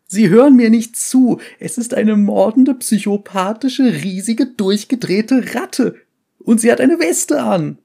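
high, loud frantic rant; wild unhinged ranting. Acted. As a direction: rant like nobody believes you. Survivor ranting, not heard.

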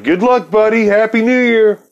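a hammed-up cheery send-off; mocking goodbye. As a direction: chirp it as you bail out. Brushing him off, leaving.

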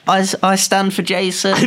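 high, put-on voice reading a comment; squeaky fake fan voice; mock commenter voice. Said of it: "You put in the comments, like".

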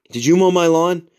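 high-pitched accusing question; retold aggressive demand. Sweaty man confronting his neighbor, aggressive. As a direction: re-enact a huffy, pushy confrontation. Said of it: "really aggressively, like, sweaty, huffing".

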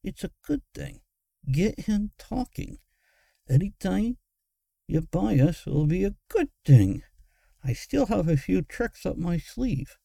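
high-pitched conspiratorial mumble; mumbling schemer. A narrator's reading. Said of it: "Moggitz mumbled, barely moving his mouth".

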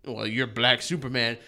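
low, cool tough-guy taunt; a hint of a smug action hero; lightly voiced Rock one-liner. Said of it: "The Rock has his".

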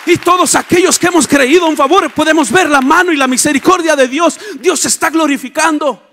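loud, high, building proclamation; full-force preaching. Real, not acted. Preacher proclaiming God is with us, full-force preaching. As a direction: build to an exultant declaration and hold it.

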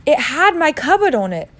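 put-on high, loud boy's protest; angry. Boy contradicts his uncle, angrily. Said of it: "said Harry angrily".

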